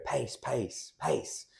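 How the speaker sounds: imitated corner shout, not at full volume; coach calling out. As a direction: call out falling cues like a coach. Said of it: "I heard Jimmy go".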